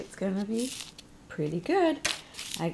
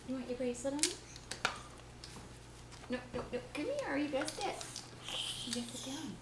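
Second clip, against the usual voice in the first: soft voice; 4 semitones higher, 13 dB quieter than usual. Real, not acted. Soft talk to baby.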